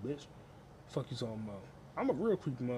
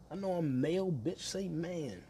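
ordinary talk, nothing marked

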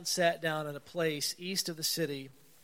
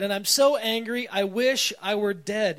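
higher, louder whiny outburst; childish tantrum; 4 semitones higher, 8 dB louder than usual. Retold or imitated. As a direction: snap back like a five-year-old stomping his feet. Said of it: "five-year-old stomping his feet".

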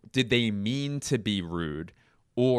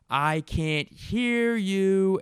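much higher, slow, drawn-out taunt; mock whiny heckler; 13 semitones higher, 5 dB louder, much slower than usual. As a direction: stretch the taunt out like a dim heckler. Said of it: "the heckler goes like".

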